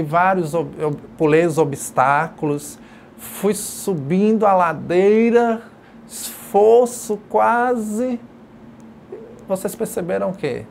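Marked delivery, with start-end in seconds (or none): 3.2-8.2 slow, strained, louder push uphill; effortful climb. Shown as a demonstration. Teacher acting out pedalling up a hill, slow and straining. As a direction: drag the words out as if labouring up a hill. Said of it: "eu expressei que eu tava subindo".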